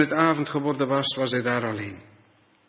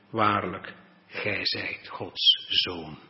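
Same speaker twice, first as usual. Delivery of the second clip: reverent awe; 4 semitones lower, much slower than usual. Acted. Disciples in the boat confess Jesus, slow reverent awe. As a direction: lower and very slow, hushed.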